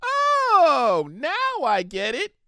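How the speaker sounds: high, drawn-out pony realisation; light up as it finally clicks; cheerful pony getting the point at last; bubbly pony. Acted. Pinkie finally understands.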